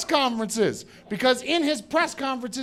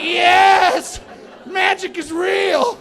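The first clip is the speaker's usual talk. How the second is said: higher, louder, slow joyful shout; excited shout; 9 semitones higher, 6 dB louder, much slower than usual. Retold or imitated. Comic retelling his thrill at the cork popping, loud and slow. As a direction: cry out in childlike wonder. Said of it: "I was so excited".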